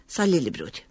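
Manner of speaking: ordinary delivery, nothing marked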